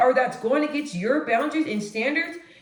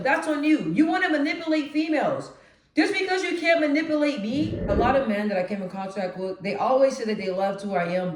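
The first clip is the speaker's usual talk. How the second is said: normal talking voice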